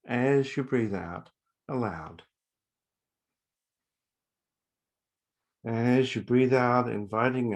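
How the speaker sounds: slow, spacious meditation guidance; guided meditation. Acted. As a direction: guide the out breath slowly, pausing between phrases. Leads a breathing meditation.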